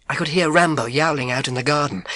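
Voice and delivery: ordinary delivery, nothing marked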